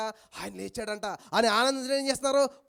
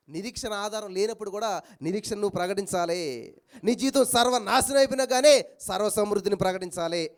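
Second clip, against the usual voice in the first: ordinary talk, nothing marked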